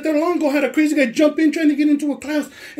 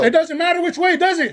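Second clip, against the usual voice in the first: raised pitch, pressing for agreement; heated challenge; 6 semitones higher than usual. Real, not acted. Man arguing with school staff over the radio, heated. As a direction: argue it, demanding they concede.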